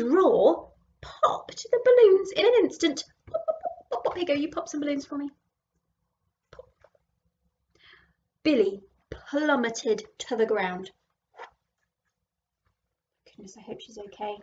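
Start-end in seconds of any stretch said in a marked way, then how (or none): 3.3-4.1 popping sounds. Acted. Balloons popping. 13.3-14.4 softly whispered, fast and worried. Real, not acted. Worried aside to listener.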